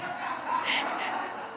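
a soft breathy laugh. Real, not acted. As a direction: let a quiet chuckle out. Laughs at own story.

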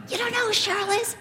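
high, disbelieving mock question; incredulous. Shopper to clueless friend about Cheryl, incredulous.

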